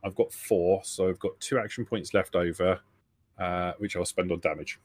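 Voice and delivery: ordinary talk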